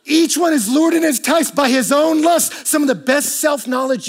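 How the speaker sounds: loud, high, driving sermon delivery; preaching at full force. Acted. Pastor pressing the congregation about loneliness, at full force. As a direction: preach it out, building.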